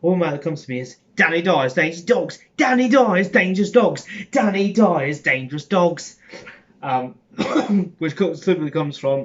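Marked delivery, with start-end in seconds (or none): 1.1-6.2 higher, shouted Cockney catchphrase, repeated with gusto; loud mock Cockney. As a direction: bellow it like a Cockney TV trailer. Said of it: "Oh, you're too Cockney accent".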